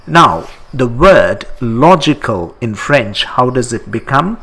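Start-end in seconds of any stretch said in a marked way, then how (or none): none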